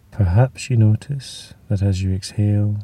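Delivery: normal talking voice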